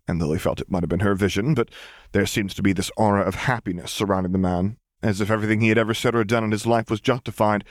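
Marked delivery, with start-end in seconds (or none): none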